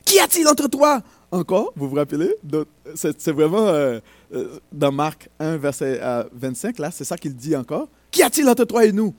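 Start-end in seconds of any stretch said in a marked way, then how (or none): 0.1-1.0 crié nettement plus aigu et plus fort, comme un démon; le démon s'écrie devant Jésus; cri d'une voix forte. Acted. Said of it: "Il s'écria d'une voix forte". 8.1-9.1 voix forte. Acted. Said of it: "d'une voix forte".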